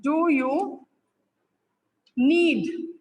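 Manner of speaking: slow, word by word with long gaps; slow dictation. Shown as a demonstration. Online teacher dictating a sentence to her class, slowly. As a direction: dictate it so students can type along. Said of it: "मैं कहती हूँ".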